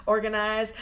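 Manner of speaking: ordinary delivery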